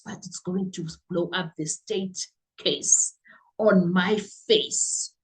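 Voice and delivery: quietly realizing disaster, as if thinking to yourself; imitated stunned mutter, fading into a whisper; dawning realization. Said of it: "like a man who realized mid-cross examination".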